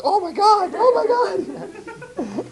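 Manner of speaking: very high loud delighted shriek; ecstatic squeal. Retold or imitated. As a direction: kids see their photo on the camera screen and squeal in ecstatic delight. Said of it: "it's ecstasy".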